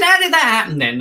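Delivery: high, playful interviewer; talking to a child. Retold or imitated. Performer questions kid with plaster, high and playful. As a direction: lift it high, asking a little kid.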